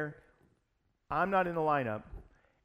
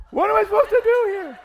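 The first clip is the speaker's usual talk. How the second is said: exasperated shout; 16 dB louder than usual. Retold or imitated.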